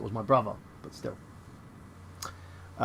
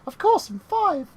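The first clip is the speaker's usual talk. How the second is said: put-on much higher kid voice, faster on the excuse; whiny little kid; 4 dB louder, slower than usual. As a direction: plead like a clueless child. Child answering sister.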